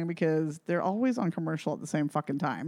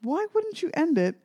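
higher, drawn-out exasperation with pauses; exasperated; 5 semitones higher, 3 dB quieter, slower than usual. Real, not acted. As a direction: draw it out in disbelief. Exasperated rant about the ending.

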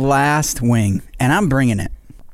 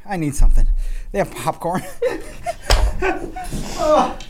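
high giggly laughter through pain; laughing; 12 semitones higher than usual. Real, not acted. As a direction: laugh helplessly while burning. Laughing through spicy-food pain.

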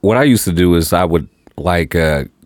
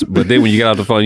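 usual speaking voice

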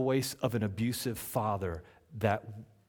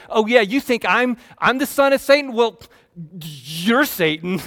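loud, higher playground retort; kids' comeback; 10 semitones higher, 14 dB louder than usual. Retold or imitated. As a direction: hurl it back like a squabbling kid. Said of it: "It's like these kids that are fighting".

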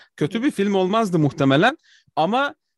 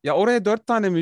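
ordinary delivery

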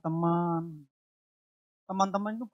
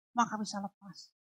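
Maka bisa lepas repeated much softer; hushed repeat of Maka bisa lepas; soft echo; 4 semitones higher, 7 dB quieter than usual. Acted. Echoed softly.

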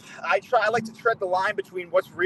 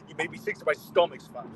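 put-on Canadian accent; 7 dB quieter than usual. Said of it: "that's not even a good Canadian accent".

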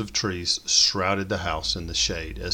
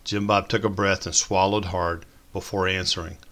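plain reading voice

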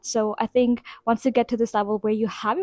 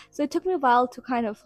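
ordinary talk, nothing marked

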